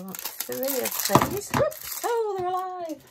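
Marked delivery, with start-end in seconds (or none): 1.5-3.0 sudden high squeal of surprise; startled whoops. Real, not acted. Surprised squeal as batteries scatter across table. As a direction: yelp as the batteries jump away.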